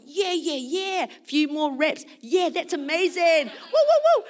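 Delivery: gleeful gym cheer; excited cheering. Retold or imitated. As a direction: cheer yourself on, whooping at the end. Acts out her excitement at gym.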